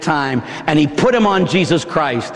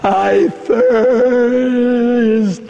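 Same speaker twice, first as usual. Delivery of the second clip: dying Jesus; 5 semitones higher than usual. Acted. Jesus voiced on the cross.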